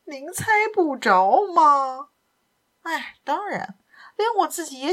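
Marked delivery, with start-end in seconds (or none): 0.1-2.1 slow, higher, teasing question; detective taunting the captain slowly; knowing tease. Acted. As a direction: draw it out like you know.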